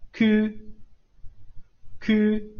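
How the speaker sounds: 提高音调示范く; model ku. Shown as a demonstration. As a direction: say く carefully for the class. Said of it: "这个音不好发哈".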